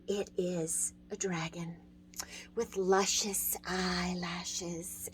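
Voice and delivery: slow playful whisper. Real, not acted. Teacher to viewers, teasing a silly dragon, whispered. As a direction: lean in and whisper it slowly, savoring it.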